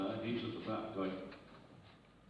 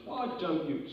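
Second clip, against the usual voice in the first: higher, louder comeback; cheeky retort; 6 semitones higher, 5 dB louder, slower than usual. Acted. Swearing parrot answers back to its owner, cheeky retort.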